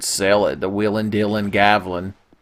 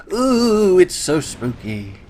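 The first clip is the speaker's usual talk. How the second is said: high swooping ghostly ooh, then slow; mock spooky; 9 semitones higher, slower than usual. Gamer teasing a skull-like enemy, mock spooky. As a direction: draw it out like a playground ghost.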